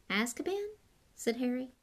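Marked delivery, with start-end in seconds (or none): 0.1-0.7 high, rising question; puzzled. A narrator's reading. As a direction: echo an unknown word in puzzlement.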